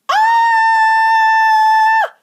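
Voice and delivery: very high, loud sustained scream; excited scream. A narrator's reading. Veruca spotting someone across the river, piercing excited scream. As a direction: shriek and hold it high. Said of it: "filled with screams of excitement".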